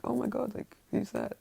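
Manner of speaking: high-pitched startled gasp of words; surprised. Acted. She opens the door on them, surprised. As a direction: act out a surprised reaction, voice jumping up. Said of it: "she's, like, surprised".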